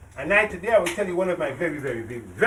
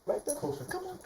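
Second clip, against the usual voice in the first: soft coaxing; 11 semitones higher, 13 dB quieter than usual. Acted.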